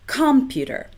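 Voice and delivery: computer stressed on COM, com-puter; stress on first syllable. Shown as a demonstration. Teacher showing wrong stress on first syllable. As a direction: punch the first beat of computer. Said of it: "Do we say one".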